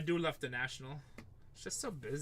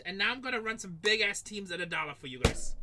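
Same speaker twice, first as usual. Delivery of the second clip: normal talking voice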